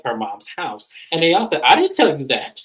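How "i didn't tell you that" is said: higher and louder protest, rising on the repeat; mock denial. The girls denying they said it, louder and higher. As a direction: deny it twice, building.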